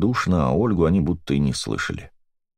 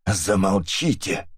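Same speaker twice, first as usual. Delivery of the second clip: hoarse croak; 3 semitones higher, slower than usual. A narrator's reading. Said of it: "прохрипела Эдит".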